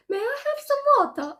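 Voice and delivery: high, quick, lilting British accent. Shown as a demonstration. Showing a friend how British people ask, British accent. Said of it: "British accents are like".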